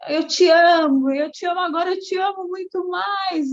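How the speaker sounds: high, loud cries of love down the street; retold shouting. Woman at the traffic light shouts her love after the driver, loud. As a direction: call it out after the departing car, joyful. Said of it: "e gritava alto".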